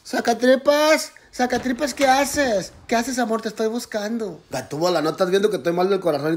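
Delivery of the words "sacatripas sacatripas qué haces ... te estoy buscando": high, sing-out searching call; high-pitched comic sweetheart; calling for a partner. Acted. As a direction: call out for your love in a high voice. Partner calls searching for Sacatripas.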